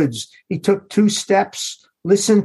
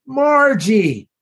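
a higher, called-out name, not full force; mild scream; 5 semitones higher than usual. A narrator's reading. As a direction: call her name into the woods, lightly. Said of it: "when he had screamed".

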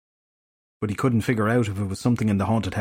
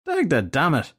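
swearing; 6 semitones higher than usual. Acted. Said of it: "Filthy Henry swore".

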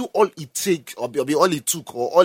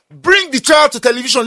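usual speaking voice

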